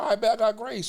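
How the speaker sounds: playing his naive younger self; high mock reply. Retelling his own reply to friend.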